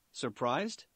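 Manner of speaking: put-on light German accent, smug; a hint of German inventor. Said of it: "speaking in a thick German accent".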